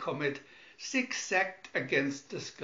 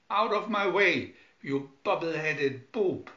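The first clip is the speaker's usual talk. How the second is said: loud angry insult; angry order; 4 semitones higher, 5 dB louder than usual. Acted. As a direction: bark him out of the way. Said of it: "ordered Smith".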